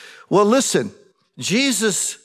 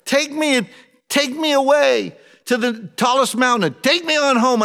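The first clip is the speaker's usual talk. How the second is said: Jesus crying out to the Father, higher and strained; pleading prayer; 7 semitones higher than usual. Acted. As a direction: beg to be taken away, voice lifted high. Preacher voices Jesus pleading in prayer.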